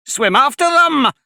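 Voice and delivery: very high, loud scream. A narrator's reading. Gryphon screams the dance instructions. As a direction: scream it wildly. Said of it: "screamed the Gryphon".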